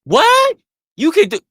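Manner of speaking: high, loud incredulous "What?"; mock disbelief. Mocking people who doubt off-menu orders, incredulous. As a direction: play the shocked skeptic, big and high. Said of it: "Most people don't think".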